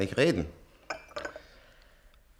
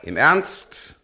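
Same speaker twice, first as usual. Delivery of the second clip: louder, insisting; emphatic; 8 dB louder, slower than usual. Acted. Old man insists to the nurse, louder. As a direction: press the point home loudly.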